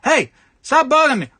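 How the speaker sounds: high, loud mock snap; hammed-up hang-up yell; annoyed caller. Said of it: "some guy goes like".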